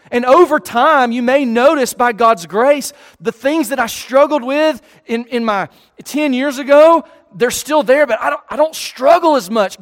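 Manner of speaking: preacher pleading with his flock; preaching. Acted. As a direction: press the point home to the room. Preaching to the congregation.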